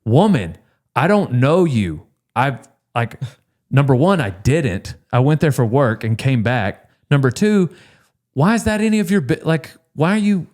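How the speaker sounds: mock-furious scolding, pitch climbing; retold angry rant. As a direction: tell her off like you're fed up. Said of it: "I was mad and I cussed her".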